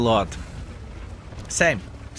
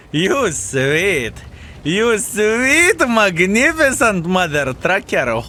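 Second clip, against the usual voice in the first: excited; 5 semitones higher, much slower than usual. Real, not acted. Celebrating a great play.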